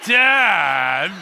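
put-on exasperated teen drawn-out Dad; teen daughter sighing Dad at her father; eye-roll tone. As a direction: roll your eyes with your voice. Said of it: "The eyes are like this".

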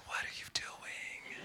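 slow, hushed whisper as the sleepy wife; drawn-out whisper. Retold or imitated. Snuggled-up wife to her wide-awake husband, slow whisper. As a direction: whisper it very softly, stretching the words.

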